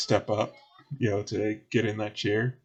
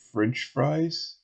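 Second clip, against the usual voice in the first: lightly voiced puzzled bartender, higher pitch; questioning bartender; 5 semitones higher than usual. Bartender checking a customer's order, questioning. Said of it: "he's like".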